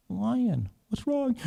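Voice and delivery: a tiny mouse worried for the lion; concerned mouse. Acted. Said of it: "the mouse said".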